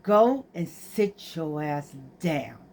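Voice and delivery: low, slow mother's order; retold warning with restraint; stern scolding. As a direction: lay down the law, drawn out and low. Retells scolding her son.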